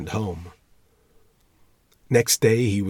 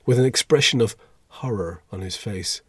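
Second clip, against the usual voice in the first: ordinary delivery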